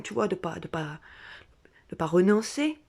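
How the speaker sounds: normal talking voice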